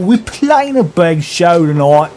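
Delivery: put-on British rocker accent. Band member calling to the robber at the pump, in a British accent. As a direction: hail him in a cheery British rocker accent.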